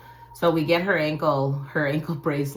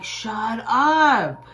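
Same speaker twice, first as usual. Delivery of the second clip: high, louder "shut up" of amazement; disbelief; 7 semitones higher, 6 dB louder than usual. Retold or imitated. Hearing his sister hugged herself too, loud amazed disbelief. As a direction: blurt it out in disbelief.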